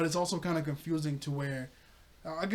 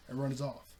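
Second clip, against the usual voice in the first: ordinary delivery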